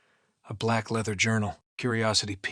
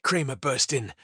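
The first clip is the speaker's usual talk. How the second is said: ordinary delivery, nothing marked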